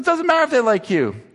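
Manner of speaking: a hint of exasperated mother; lightly voiced mother. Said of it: "She goes".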